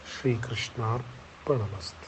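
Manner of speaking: low, very soft closing invocation; softly murmured prayer of offering; hushed devotional dedication. Real, not acted. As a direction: offer it up quietly and reverently, dropping low.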